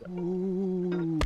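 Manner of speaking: normal talking voice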